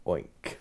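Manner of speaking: oink. Pretend. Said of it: "Minus the oink".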